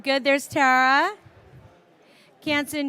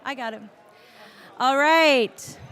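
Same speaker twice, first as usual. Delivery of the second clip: ordinary talk, nothing marked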